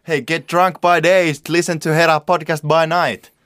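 drawn-out mock announcer intro; Joe Rogan. Host plugging their own podcast as a parody intro, loud announcer impression. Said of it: "Se on silleen, että".

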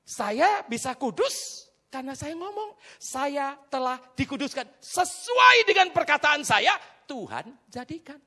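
slow, loud, high proclamation; deliberate full-voiced preaching; emphatic preaching. Real, not acted. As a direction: stretch each phrase out forcefully.

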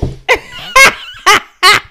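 high, loud burst of laughter. Real, not acted. Friend cracking up at a crying joke, loud laugh.

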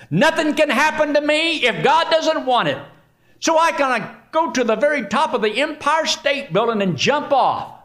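high-pitched, overconfident boasting; blithely sure of divine protection; cocky believer. Acted. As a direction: boast like nothing can touch you. Acts out a reckless overconfident believer.

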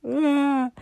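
high, loud mock wail; imitated crying outburst. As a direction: burst out in an imitation cry. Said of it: "I was just".

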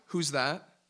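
ordinary delivery

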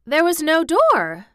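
high, loud, slow protest; incredulous cry. A narrator's reading. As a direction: insist in astonishment. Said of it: "cried Colin".

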